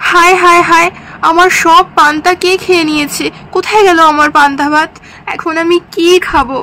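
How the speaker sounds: hammed-up lament over a loss; theatrical crying complaint; tearful wailing. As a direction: wail in dismay, as if robbed. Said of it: "সকাল সকাল তুমি কান্নাকাটি শুরু করে দিয়েছো".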